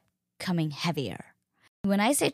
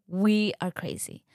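ordinary talk, nothing marked

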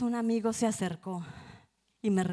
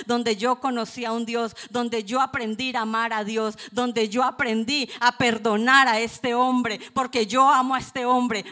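louder, fervent testimony preaching; 3 semitones higher, 8 dB louder than usual. Real, not acted. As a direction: proclaim it to the congregation. Testimonio predicado con fuerza. (predicando con fervor)

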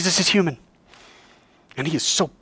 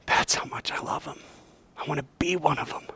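hushed devotion; 11 dB quieter than usual. Retold or imitated. Jesus tender to God.

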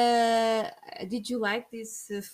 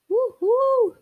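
mock cheer; 10 dB louder than usual. Cheering for Ronaldo.

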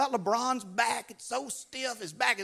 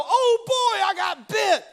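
high, loud, self-pitying wail; mock whining victim; 11 semitones higher, 8 dB louder, slower than usual. Preacher mocking a bitten churchgoer, loud mock wail. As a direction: ham up the poor-me cry. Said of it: "Don't go".

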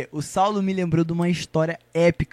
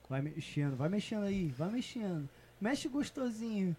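very quiet, distant in the mix; barely audible, far in the background; faint faulty mic; 4 semitones lower, 14 dB quieter than usual. Real, not acted. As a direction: talk faintly through a failing microphone. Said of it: "Tá bem baixinho, lá no fundo".

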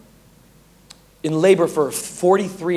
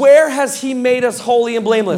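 rising preaching fervor; 6 semitones higher than usual. Acted. Preacher urging the church toward holiness, fired up. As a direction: lift the church with each step.

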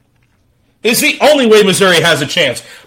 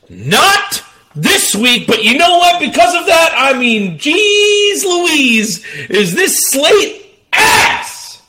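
hyped-up rant; 8 semitones higher, slower than usual. Real, not acted. Hyped rant about the week's games.

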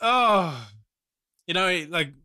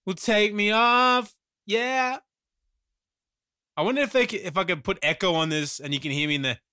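ordinary talk, nothing marked

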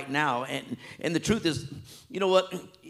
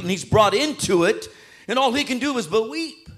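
loud, high, full-force preaching; fervent pulpit climax; impassioned preaching; 7 semitones higher, 6 dB louder than usual. Acted. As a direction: drive it home like a revival preacher.

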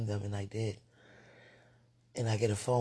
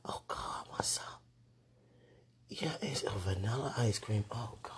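ordinary talk, nothing marked

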